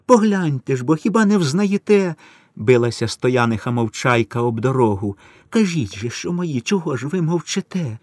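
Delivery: high, desperate pleading; begging. A narrator's reading. Mother begs the villagers to claim her sons, high and desperate. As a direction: beg the villagers for help. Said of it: "благала мати".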